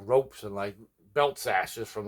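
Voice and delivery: ordinary talk, nothing marked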